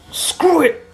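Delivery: high, loud cry of resolve; hammed-up anime power-up yell; defiant shout. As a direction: throw caution away and yell it. Hero going all out.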